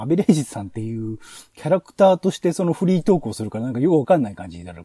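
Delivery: ordinary delivery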